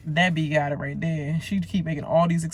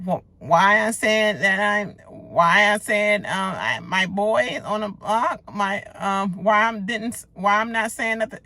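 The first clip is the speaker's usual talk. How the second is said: higher, stammering mock panic; flustered mimic; 4 semitones higher than usual. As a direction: mimic her fumbling excuse. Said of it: "She's like".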